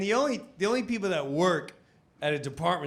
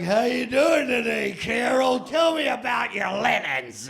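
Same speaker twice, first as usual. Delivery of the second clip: loud prank caller; 7 semitones higher, 5 dB louder than usual. Pretend.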